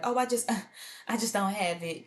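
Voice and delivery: hammed-up fake excuse; pleading broke. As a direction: play poor so they pay. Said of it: "who act like".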